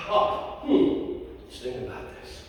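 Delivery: hushed, breathy mock pondering. Speaker mocking calm thought in a soft whisper. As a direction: whisper it like weighing it calmly.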